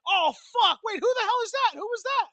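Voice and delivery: ecstatic, high shrieking cheer; excited screaming. Real, not acted. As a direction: scream with joy at the screen. Big match moment on screen.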